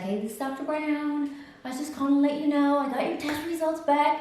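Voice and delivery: high, chirpy doctor voice; chipper phone caller. Retold or imitated. Doctor phones patient with test results, chipper. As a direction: imitate a cheery caller in a high, lilting voice. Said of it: "she's talking just like this".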